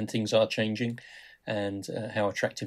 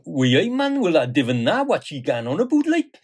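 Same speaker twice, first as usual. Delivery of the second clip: hammed-up Geordie accent, much higher and louder; 6 semitones higher, 7 dB louder than usual. Grandad reading stories to grandkids, in a hammed-up Geordie accent. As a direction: put on a broad, comic Geordie accent for the kids. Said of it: "I put on different accents".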